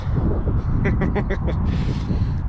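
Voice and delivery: short burst of unvoiced laughter. Real, not acted. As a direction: laugh at your own silly remark. Laughs at his own silly words.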